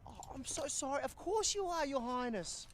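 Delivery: high, sing-song sarcastic deference; mock him like royalty; sarcastic mock bow. Mocking a comrade as princess.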